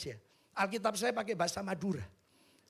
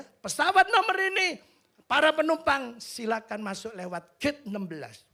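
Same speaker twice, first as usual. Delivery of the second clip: high, loud mock airport announcement; announcer voice; 6 semitones higher, 7 dB louder than usual. Pastor imitating the airport call to passengers, loud. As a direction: call it out like the gate speaker.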